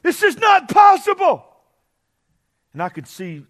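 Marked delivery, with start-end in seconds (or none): 0.0-1.4 high, loud, slow cry of despair; Mary screaming. Pretend. Mary in labor screaming, high and loud. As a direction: cry out that she can't go on. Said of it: "Would you not imagine that".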